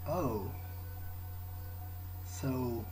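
ordinary talk

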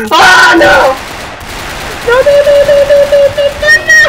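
very high, loud rapid-fire no's; panicked shouting. Real, not acted. Gamer losing in the match, panicked shouting. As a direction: shriek it in a gaming panic.

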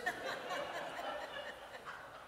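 soft breathy high laugh; light laugh. Real, not acted. Preacher laughing at his joke to congregation, soft laugh. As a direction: chuckle at your own joke.

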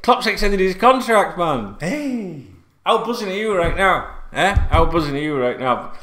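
usual speaking voice

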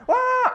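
loud high mock scream; imitated scream. Client hooked to a machine, screaming at the sparks: loud high mock scream. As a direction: shriek like the startled client. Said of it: "they literally screaming like".

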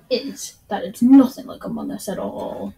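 nothing punched hard and loud; stress on nothing. Real, not acted. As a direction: hit nothing, loud and emphatic. Joking insistence, stress on nothing.

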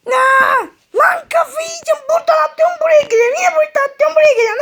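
losing his mind, voice climbing; frantic. Acted. Trapped in a portal loop.

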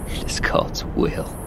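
slow whispered echo; bitter whisper. A narrator's reading. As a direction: breathe it to yourself, bitterly. Old narrator whispers to himself.

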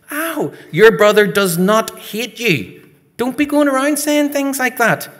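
put-on a mum telling her boy off; scolding mother. Mother scolds son for saying that.

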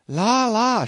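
high, loud startled cry of a maid; chambermaid crying out. A narrator's reading. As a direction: shriek it like a flustered maid. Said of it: "she cried".

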